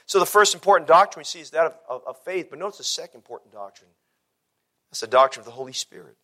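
low, softened sermon voice with weighty pauses; hushed, solemn preaching. Real, not acted. Preacher teaching the church about faith and the Holy Spirit, hushed. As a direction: bring it right down and let each point settle.

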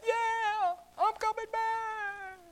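high, slow, distant call; far-off reply. Acted. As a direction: answer as if from over the hills. Shepherd answers from far hills.

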